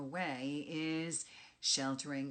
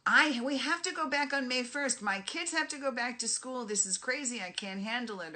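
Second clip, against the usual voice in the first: high, loud, worked-up outburst; anxious client, agitated; 7 semitones higher, 6 dB louder than usual. Retold or imitated. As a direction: play the panicking client who wants things back now. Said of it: "they start to get anxious and excited and they start yelling".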